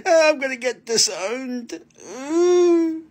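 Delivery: high giggly voice; laughing. Real, not acted. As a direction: giggle through the words. Laughing at own joke.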